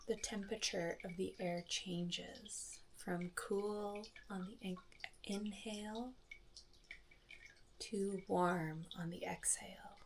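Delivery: soft, unhurried meditation voice with long pauses; soft calm phrases, spaced out by silence; guided meditation. Acted. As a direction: guide listeners gently through each breath. Leads a guided breathing meditation.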